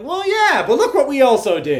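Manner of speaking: high and loud, laughing; excited high protest. Retold or imitated. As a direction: pitch it up in mock protest. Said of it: "I'm like".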